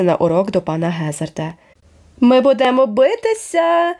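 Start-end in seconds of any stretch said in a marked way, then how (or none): 2.2-3.9 slow, high yelled challenge; howling threat. A narrator's reading. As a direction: wail out the dare. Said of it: "заввив він".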